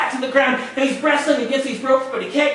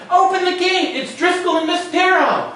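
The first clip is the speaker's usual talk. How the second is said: high shouted call; shouting; 7 semitones higher than usual. Acted. Crewman shouts down to the other side, high shout. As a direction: call it down to the crew below. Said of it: "the man shouts down".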